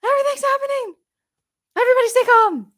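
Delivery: very high squeaky panic; mock panic squeal; overwhelmed squeal. Real, not acted. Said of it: "I'm getting emotional".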